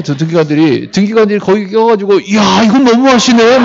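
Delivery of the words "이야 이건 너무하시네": drawn-out, loud, high mock indignation; mock outrage. Registrar butting in over a transfer, hammed-up outrage. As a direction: exclaim in exaggerated dismay, stretching it out. Mocks meddling registrar objecting.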